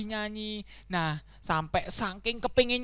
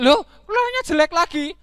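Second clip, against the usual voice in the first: high, loud cry of dismay; high-pitched upset donkey; 8 semitones higher, 9 dB louder than usual. Acted. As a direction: whine in disbelief. Donkey fails singing again.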